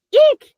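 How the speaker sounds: sharp high squeal of surprise; startled squeak. Shown as a demonstration. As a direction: squeal like you just saw a mouse. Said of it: "I'm gonna be surprised".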